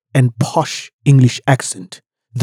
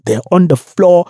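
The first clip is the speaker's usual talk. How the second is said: ordinary talk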